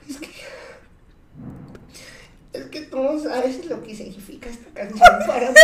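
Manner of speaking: mock crying voice, high and soft; tearful drunk friend. Drunk Gaby hugging her friend and crying, mock tearful. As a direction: whimper it through laughter, imitating a weepy drunk. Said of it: "me empieza a chillar y me dice".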